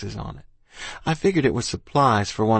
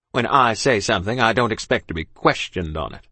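imperious British rebuke; lightly voiced officer putting a child in place; stern officer. A narrator's reading. Officer to child who questioned him, stern.

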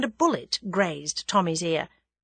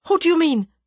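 much higher, louder shocked question; alarmed question; 8 semitones higher, 6 dB louder than usual. A narrator's reading. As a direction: demand an answer in alarm. German shocked, face pales.

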